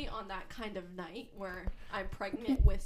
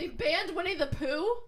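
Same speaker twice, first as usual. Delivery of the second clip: surprised; 7 semitones higher, 7 dB louder than usual. Real, not acted. Shocked question.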